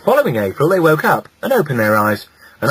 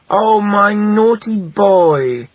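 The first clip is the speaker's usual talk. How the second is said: slow, high, drawn-out fondness; tender relief; 9 semitones higher, 5 dB louder, much slower than usual. A narrator's reading. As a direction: savour each word with love. Mother reunited with lost son.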